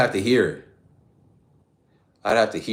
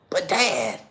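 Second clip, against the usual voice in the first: put-on higher, whiny kid voice; whiny Bobby; 8 semitones higher than usual. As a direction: whine back at your father. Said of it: "King of the Hill".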